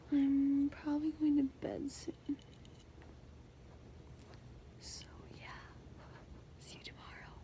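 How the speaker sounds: soft bedtime whisper. Real, not acted. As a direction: whisper quietly at night.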